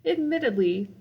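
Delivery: ordinary delivery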